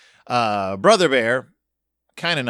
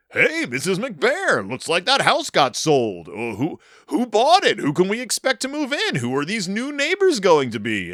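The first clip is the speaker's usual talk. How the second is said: hammed-up nosy dad bear, higher; Papa Bear voice; 3 semitones higher than usual. Papa Bear calling from the window to Missus McBear, hammed-up nosy. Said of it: "He's all".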